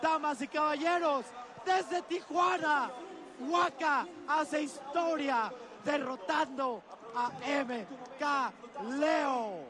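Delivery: slow ring announcer call; lucha libre announcer. Acted. Announces the winner in Spanish.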